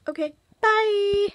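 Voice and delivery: high, slow sing-song farewell; sing-song goodbye. Real, not acted. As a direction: wave them off brightly. Sign-off to viewers.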